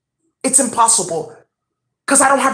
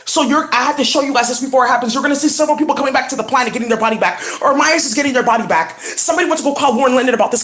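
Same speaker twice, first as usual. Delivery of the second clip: usual speaking voice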